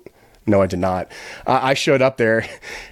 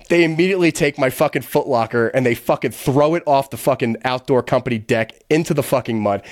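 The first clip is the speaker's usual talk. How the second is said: usual speaking voice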